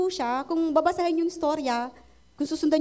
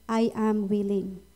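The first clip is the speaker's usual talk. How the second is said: tender Jesus voice; 8 semitones lower, slower than usual. Retold or imitated. Preacher voices Jesus speaking now.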